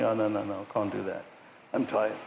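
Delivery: lightly voiced tired excuse. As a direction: mimic a lazy churchgoer begging off. Mocking tired churchgoer's excuse.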